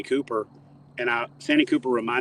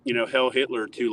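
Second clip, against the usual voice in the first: ordinary talk, nothing marked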